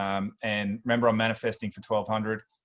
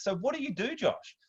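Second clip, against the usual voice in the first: high, softer mum voice; high-pitched, inquisitive mum impersonation; curious mum; 11 semitones higher, 5 dB quieter than usual. Retold or imitated. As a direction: imitate a mum making polite enquiries, pitched right up. Mum quizzing Josh in retold story.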